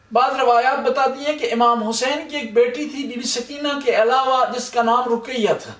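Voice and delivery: ordinary delivery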